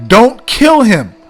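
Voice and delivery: dramatic reading aloud. Shown as a demonstration. Reads the letter aloud.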